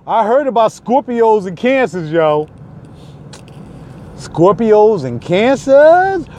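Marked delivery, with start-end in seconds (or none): 4.2-6.3 slow, drawn-out question; slow mock question. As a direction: stretch it out in disbelief.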